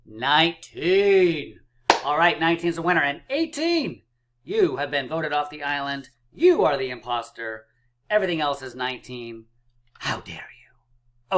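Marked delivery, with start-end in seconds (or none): 0.1-1.6 drawn-out triumphant count. Acted. 3.0-7.6 game show host. Pretend. Mock game-show vote off. 10.0-11.4 breathy, slow, offended whisper; mock offended whisper.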